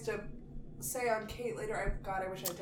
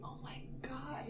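breathy hushed whisper; 4 semitones higher, 12 dB quieter, slower than usual. Retold or imitated. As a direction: hush it like a secret. Whispered childhood memory.

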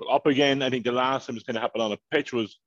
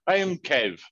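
ordinary talk

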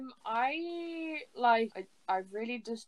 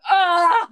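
sudden loud shriek of horror; horrified shriek; 10 semitones higher, 16 dB louder than usual. Retold or imitated. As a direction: yelp in mock horror. Horrified at own side profile.

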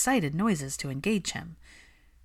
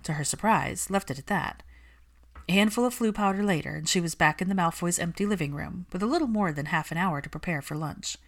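ordinary delivery, nothing marked

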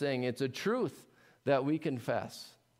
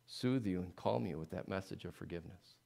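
soothing; 4 semitones lower, 5 dB quieter than usual. Acted. Soothing close of quote.

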